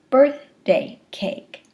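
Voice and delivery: BIRTH, DAY, CAKE as three slow separate beats; syllable number demo. Shown as a demonstration. Lecturer showing syllable number, "birthday cake" in three slow beats. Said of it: "you can hear syllable number".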